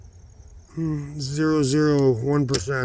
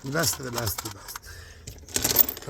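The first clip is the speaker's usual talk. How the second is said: hushed emphasis; 12 dB quieter, slower than usual. Real, not acted. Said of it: "these in here are my best".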